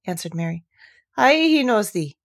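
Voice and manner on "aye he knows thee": ordinary delivery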